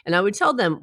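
ordinary delivery

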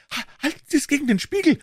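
put-on high, fast, stammering young man; excited Offerton. A narrator's reading. Offerton urging Holmes to check the mirror, high and excited. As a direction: blurt it out high and quick. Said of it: "Offerton war ganz aufgeregt".

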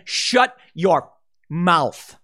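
very slow, drawn-out curt shutdown; snapping retort. Retold or imitated. People pushed too far snapping at critics, slow drawn-out retort. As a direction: drag out each word like a final warning. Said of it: "people snap and say".

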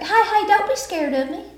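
high, coaxing Southern vampire voice; high-pitched friendly Southern vampire; sweet Southern vampire. Pretend. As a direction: coax the victim with Southern charm. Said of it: "You're like".

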